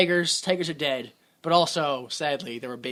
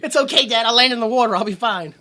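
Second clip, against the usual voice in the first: higher, louder breezy reassurance; mock-casual son; 5 semitones higher, 6 dB louder than usual. Son assuring his dad, higher breezy mock reassurance. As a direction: play the son shrugging off a fatal jump.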